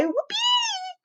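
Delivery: very high whoopee, a squeal; squeal of joy. Acted. Second girl squeals her joy at the trip, very high. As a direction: squeal with delight. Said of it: "cried Ashley B".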